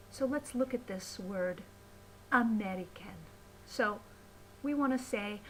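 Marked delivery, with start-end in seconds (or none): 2.3-3.2 "American" with a full "can" at the end; over-careful "American", no schwa in the last syllable; fully enunciated syllables. Shown as a demonstration. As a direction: say "American" as a-meh-ree-CAN. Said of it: "instead of a in the syllable can".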